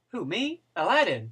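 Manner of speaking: high startled "who, me"; surprised Aladdin line. Acted. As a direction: squeak it out in surprise. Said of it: "that's my opening line".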